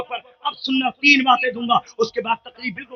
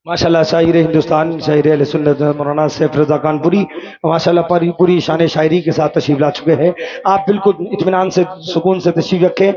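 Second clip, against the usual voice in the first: stage announcement; 7 semitones lower than usual. Real, not acted. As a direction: announce the poets to the seated gathering. Stage announcer introduces poets.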